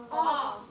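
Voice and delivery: a loud, drawn "Aw" for the letter O; teacher modelling a vowel sound; short o sound. Shown as a demonstration. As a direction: model the vowel "Aw" clearly for children. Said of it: "what sound does the O make".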